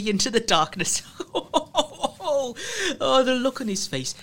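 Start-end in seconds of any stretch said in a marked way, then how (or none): 1.1-2.2 high wicked burst of laughter; gleeful cackle. Real, not acted. Storyteller gloating over his prank, wicked cackling laugh. 2.3-4.1 higher, still laughing through the words; laughing gleefully. Real, not acted. Storyteller relishing the fallen lad's face, laughing.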